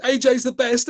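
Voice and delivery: high, loud fawning mock gush; mocking Eddie Hearn. Mocking the promoter's praise on camera, high fawning gush. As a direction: gush like a starstruck fanboy. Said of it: "and he said".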